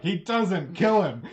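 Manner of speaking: loud urgent yell; shouting. Acted. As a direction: bark it out loud. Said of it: "the fox guy behind you is like".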